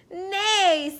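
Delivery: drawn-out high neigh; horse neigh. A narrator's reading. Narrator voicing a horse, high falling neigh. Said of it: "said a horse".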